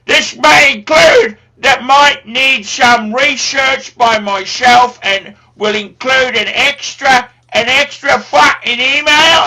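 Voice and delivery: loud ranting Cockney trader voice, swearing at the clients; shouting Cockney character. Acted. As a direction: bellow the price list in a Cockney accent. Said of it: "getting a bit carried away there with the character".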